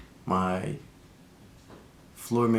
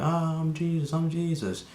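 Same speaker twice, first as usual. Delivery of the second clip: mock yell; 8 semitones higher than usual. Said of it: "all this yelling".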